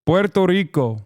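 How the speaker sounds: "Puerto Rico" with a rolled R in Rico. Shown as a demonstration. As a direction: roll the R hard in "Rico". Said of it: "Roll the R in Puerto Rico".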